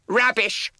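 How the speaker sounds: very high, loud snapped retort; snapping witch. Acted. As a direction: snap it at them. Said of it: "snapped Edna".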